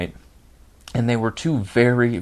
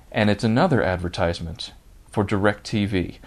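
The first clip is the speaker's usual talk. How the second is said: ordinary delivery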